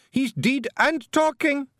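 shouted Scots wife; exasperated shout. Acted. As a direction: bellow it down the hole. Jeanie shouting down hole.

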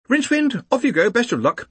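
high, hurrying call; high-pitched organiser urging someone on; insistent send-off. A narrator's reading. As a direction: call the reluctant man forward in a high voice. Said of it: "Ponder repeated".

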